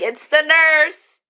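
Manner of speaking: loud, high sing-out; acted cheery announcement. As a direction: call it out slowly, high and loud. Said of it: "Be like".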